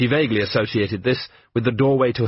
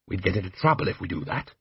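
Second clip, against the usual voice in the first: hushed; 3 semitones lower, 5 dB quieter than usual. Acted. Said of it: "held a whispered argument".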